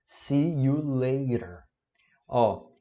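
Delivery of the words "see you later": slow, clear teacher model; slow model phrase. Shown as a demonstration. Teacher modeling "see you later" slowly for students. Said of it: "É o nosso te vejo mais tarde".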